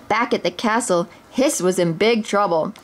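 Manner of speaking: plain reading voice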